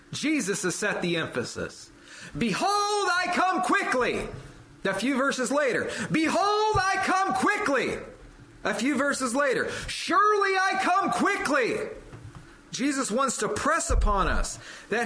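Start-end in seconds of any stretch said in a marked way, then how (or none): 2.4-4.3 higher, slower proclamation; rising preacher's cry; proclaiming. Acted. Preacher builds quote repetition. 6.1-8.0 high, slow ringing shout; full-force pulpit shout; preaching shout. Acted. Preacher builds quote repetition. 9.9-11.8 high ringing shout on surely; full-force pulpit proclamation; preaching shout. Acted. Preacher builds quote repetition.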